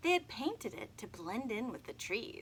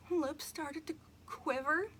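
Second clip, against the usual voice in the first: ordinary delivery, nothing marked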